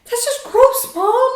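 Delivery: high, loud disgusted whine to mom; grossed-out teen. Retold or imitated. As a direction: whine it like a teenager to his mother. Nephew's reaction quoted again.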